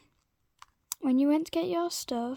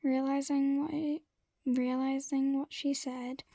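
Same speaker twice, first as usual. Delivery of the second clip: ordinary delivery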